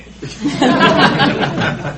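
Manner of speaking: high, loud rueful laugh; a big helpless laugh at the irony; real burst of laughter. Real, not acted. Laughs at going to Disneyland.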